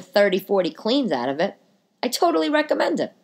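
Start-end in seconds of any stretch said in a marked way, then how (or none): 2.0-3.1 eager pitch. Real, not acted. Product recommendation.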